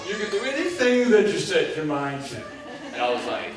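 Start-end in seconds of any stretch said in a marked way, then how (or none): 0.1-2.4 a hint of the upbeat teacher, pitched high; teacher's motto. Twelfth grade teacher preaching his motto, mildly imitated and high. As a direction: lightly voice the teacher's big catchphrase. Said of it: "his big phrase was".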